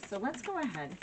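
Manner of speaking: ordinary talk, nothing marked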